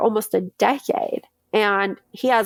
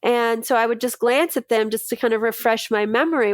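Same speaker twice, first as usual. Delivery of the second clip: ordinary talk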